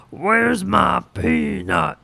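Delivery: slow, put-on gruff squirrel. Squirrel demanding its peanut, slow put-on voice. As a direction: drag it out like a pushy critter. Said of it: "That's what he sounded like".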